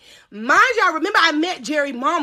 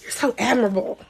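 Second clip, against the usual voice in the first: mock admiration; 4 semitones lower, 5 dB quieter than usual. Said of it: "I'm like".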